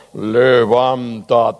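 very slow, drawn-out divine command; deep voice of God. Shown as a demonstration. Pastor shows the congregation a deep God voice, slow and commanding. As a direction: sound like a mighty God calling out. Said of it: "Y pongo otra voz".

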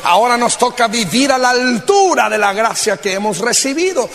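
usual speaking voice

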